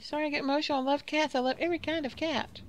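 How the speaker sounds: high, overcome gushing; emotional cat lady. Acted. Cat lady apologizes for getting emotional, high-pitched and gushing. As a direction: pitch it up, gushing over cats.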